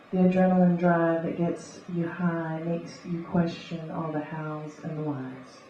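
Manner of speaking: rhythmic beat poetry reading; beatnik. Acted. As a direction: read it like a coffeehouse beat poet. Spoken word poetry reading.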